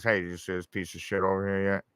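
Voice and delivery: low, soft, fast radio chatter; walkie-talkie voice. Retold or imitated. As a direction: murmur it like over a radio. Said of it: "gets his little walkie-talkie".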